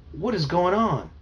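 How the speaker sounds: slow, high, loud astonishment; alarmed disbelief. Acted. Brother sees the computer vanish.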